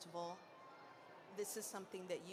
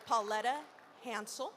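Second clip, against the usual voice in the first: loud, raised-pitch introductions; announcer roll call; 3 semitones higher, 3 dB louder than usual. Acted. Host introducing poets to the festival crowd, announcer roll call. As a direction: call each name out to a crowd.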